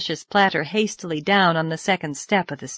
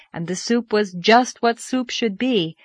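ordinary delivery, nothing marked

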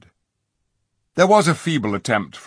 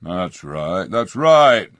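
grumbling chorus of dwarfs; growled; 6 dB louder, slower than usual. A narrator's reading. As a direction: growl agreement. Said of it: "growled the other dwarfs".

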